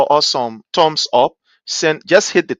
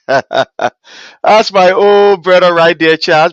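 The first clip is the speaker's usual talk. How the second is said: laughing fondly; 5 semitones higher, 9 dB louder, much slower than usual. Real, not acted. Laughing while greeting a friend.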